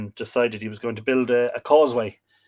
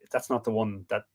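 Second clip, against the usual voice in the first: ordinary talk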